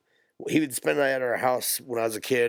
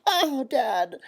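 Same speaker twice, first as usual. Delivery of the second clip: put-on much higher, louder childish whine; whiny kid voice; 4 dB louder than usual. Young son whining back at his rough father, high whine. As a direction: whimper like a little boy hurt by dad. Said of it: "He's like".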